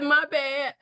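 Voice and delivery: high-pitched sheepish backdown. Retold or imitated. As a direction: back down comically, voice shooting up. Repeats sheepish reply to God.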